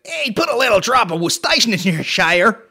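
loud, high mock TV-cook impression; Guy Fieri. Mocking Americans saying the sauce, loud hammed-up impression. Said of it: "better job than fucking Guy Fieri".

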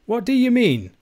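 very high, loud question; alarmed Scots outcry; cried out. A narrator's reading. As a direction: demand it as the alarmed old uncle. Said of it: "cried Ebenezer".

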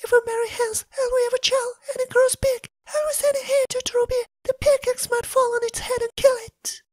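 high, soft tearful voice; crying Elsa. Acted. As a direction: whimper it out, close to tears. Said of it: "sitting crying together".

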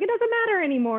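put-on dismissive young adult. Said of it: "she was like".

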